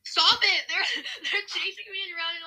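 high-pitched, half-laughing complaint; flustered protest. Real, not acted. Player chased around by others in game, flustered protest.

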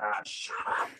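embarrassed whisper; soft, whispered mock protest; hushed retort. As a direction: whisper it to shush a teasing coworker. Retold hushed embarrassed reply to coworker.

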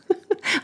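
short high giggle; a quick real high laugh. Real, not acted. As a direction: giggle at the embarrassing memory. Laughing at embarrassing memory.

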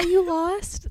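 high, gentle concern; customer service voice. Shown as a demonstration. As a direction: coo at a lost child. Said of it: "my customer service voice my baby voice".

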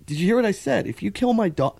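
high-pitched heated threat; retold yelling. Dad threatening a speeding driver, re-enacted high, heated yelling. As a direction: act out an incredulous, angry challenge. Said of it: "and I start yelling".

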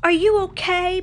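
high, loud called-out question; yelling. A narrator's reading. Pocahontas checking on her fallen friend, yelling. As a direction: yell it over the distance. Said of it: "Pocahontas yelled".

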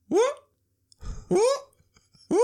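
short rising whoop; imitated dog noise. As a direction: imitate a dog's odd little whoop. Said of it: "this stupid fucking noise".

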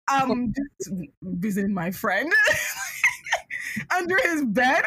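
laughing through a lame excuse; sheepish excuse dissolving into laughs. Retold or imitated. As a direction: break into laughter mid-sentence. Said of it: "I was like".

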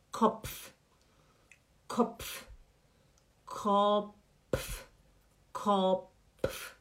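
Kopf whispered, then voiced; repeated Kopf drill. Shown as a demonstration. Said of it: "Fala Kopf".

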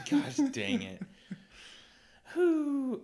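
high, giggly explanation; laughing. Real, not acted. Explaining the joke's source to a friend, giggly. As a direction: still giggling while explaining.

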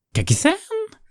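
very high lisping crone voice; lisping old neighbour. A narrator's reading. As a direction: lisp it out like a spiteful hag. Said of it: "slist ze". (hoge slissende stem van de buurvrouw)

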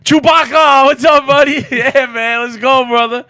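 high, loud, cheering shout; greeting the store clerk with a loud cheer; hyped-up greeting. Retold or imitated. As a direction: whoop at him like a coach. Greets the clerk loudly, retold.

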